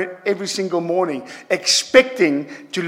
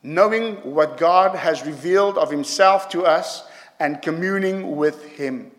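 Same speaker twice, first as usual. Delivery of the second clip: ordinary talk, nothing marked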